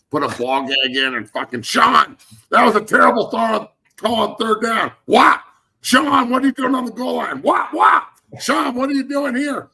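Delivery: high-pitched, full-throated mock tirade; mock-enraged player yelling. Player berating his masochist coach on the sideline, mock-enraged yelling. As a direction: scream at the coach in hammed-up outrage. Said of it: "That's Sean Payton's love language".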